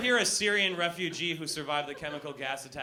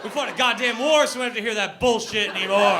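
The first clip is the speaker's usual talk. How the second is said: loud, high-pitched indignant rant; hammed-up patriot's outburst; mock patriotic rant; 4 semitones higher, 9 dB louder than usual. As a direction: rail at the British at full voice. Mock patriotic rant about the British.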